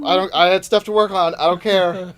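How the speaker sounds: loud mock tirade; retold crowd scolding. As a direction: lay into the audience member, loudly. Acting out scolding of a heckler.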